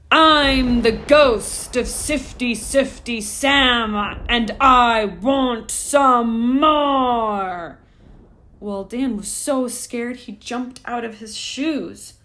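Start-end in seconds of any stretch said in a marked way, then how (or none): 0.1-7.8 slow, loud, higher booming ghost demand; roaring ghost. A narrator's reading. Hungry ghost roaring at Dan for more food, slow and loud. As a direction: drag out each word, booming and greedy. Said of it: "roared with all his might".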